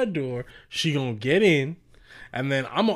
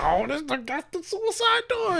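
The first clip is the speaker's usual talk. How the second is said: mock proud voice; 13 semitones higher, 5 dB quieter than usual. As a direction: show off the door in a high voice.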